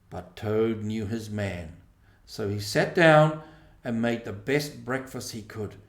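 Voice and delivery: ordinary delivery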